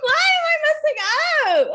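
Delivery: high, loud, laughing wail; exasperated laughing cry. Real, not acted. Host laughing at herself after another flub, loud and high. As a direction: crack up and cry out at yourself. Host flubs the outro again.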